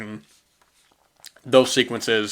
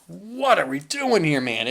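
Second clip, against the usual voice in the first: much higher, incredulous mock cry; pitched-up mocking disbelief; baffled disbelief; 6 semitones higher than usual. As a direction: throw up your hands at the screen. Mock baffled reaction again.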